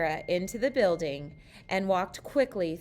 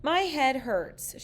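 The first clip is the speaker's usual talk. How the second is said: slow, slightly higher moan; mild moan; 7 semitones higher, 3 dB louder than usual. A narrator's reading. Sarah moaning in the kitchen, slow and mild. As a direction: complain with a touch of a groan. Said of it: "she moaned".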